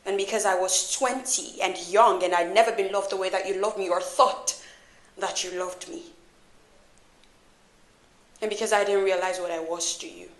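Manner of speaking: low, soft, held-back confrontation; softly spoken, controlled hurt; quiet reproach. Acted. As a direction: keep the accusation low and quiet. Hurt confrontation, holding anger in.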